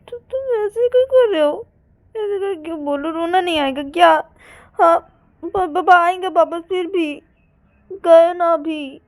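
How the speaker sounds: high, loud complaining voice; upset protest. Acted. Payal upset that her parents just left, upset protest. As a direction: wail a protest, drawing out the end.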